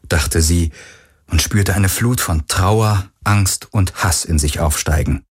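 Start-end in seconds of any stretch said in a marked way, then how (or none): none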